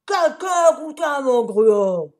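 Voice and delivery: papa bear growling over the porridge. A narrator's reading. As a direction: grumble it like an angry bear. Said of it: "grogna Papa Ours".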